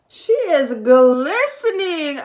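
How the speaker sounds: loud, slow, thrilled admiration; excited. Real, not acted. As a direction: gush over the glowing skin, loud and slow. Admiring her moisturized face.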